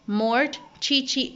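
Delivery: usual speaking voice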